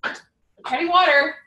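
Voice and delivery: loud, high "treading water" shot back; husband's loud retort. Retold or imitated. Husband in the pool answering her nagging, loud and high. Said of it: "He's like".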